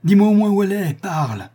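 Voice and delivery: put-on a young man shouting at the silent monk; furious demand. A narrator's reading. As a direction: demand her back at full voice. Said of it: "il exhalait ainsi sa colère".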